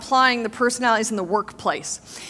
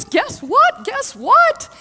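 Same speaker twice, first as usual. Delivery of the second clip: much higher, louder eager calls; excited; 11 semitones higher, 4 dB louder, much slower than usual. Retold or imitated. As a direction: burst in bubbling with news. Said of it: "And I was all excited".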